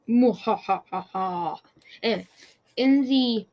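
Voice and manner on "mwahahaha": mad scientist. Pretend. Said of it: "I'm making you guys wait for it".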